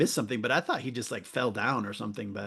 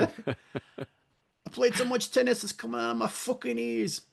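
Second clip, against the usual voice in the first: much higher Boston accent, hammed up; mock Boston accent; 11 semitones higher than usual. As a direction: mangle a Boston accent on purpose. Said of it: "I can't really do a Boston accent".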